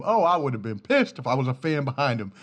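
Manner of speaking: usual speaking voice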